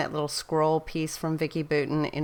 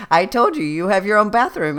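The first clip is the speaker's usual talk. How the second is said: higher, louder mock scold; 4 semitones higher, 7 dB louder than usual. Mom telling daughter to use her own bathroom, mock scolding. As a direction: scold her, half in fun. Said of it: "retold argument with daughter".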